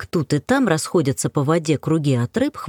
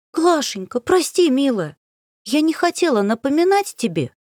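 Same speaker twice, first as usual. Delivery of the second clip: apologetic; 9 semitones higher than usual. Acted. Apologizing to upset friend.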